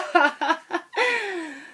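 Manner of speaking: piercing high-pitched cackle; shrill high laugh. Acted. Airport girl laughing at her own joke, piercing and high. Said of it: "The unfeasibly high pitch of her laugh".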